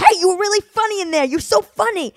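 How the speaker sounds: high, fast, loud gushing compliments; Italian-guy energy. Retold or imitated. Loud friend praises her set, high and fast. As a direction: gush like an over-eager Italian guy. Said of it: "he's got the energy of an Italian guy".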